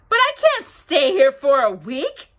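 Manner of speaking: high, loud dismayed protest; alarmed protest. A narrator's reading. Pooh protests being stuck a week.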